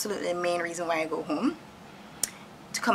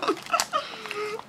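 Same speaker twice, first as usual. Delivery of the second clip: high giggle. Real, not acted. Laughs again.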